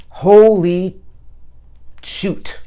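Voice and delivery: startled outburst, then a breathless hush; surprised exclamation. Real, not acted. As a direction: blurt it out in shock. Shocked at the card drawn.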